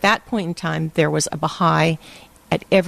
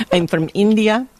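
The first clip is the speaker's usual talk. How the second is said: higher-pitched put-on Indian accent; Indira, Indian character; 5 semitones higher than usual. Radio character says where she is from, in a put-on Indian accent. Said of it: "my name is Indira".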